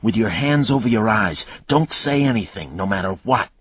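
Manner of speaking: put-on urgent, fierce command from a frightened girl. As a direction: push it through, fierce and urgent. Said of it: "Grace said fiercely".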